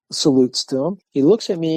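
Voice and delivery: ordinary talk, nothing marked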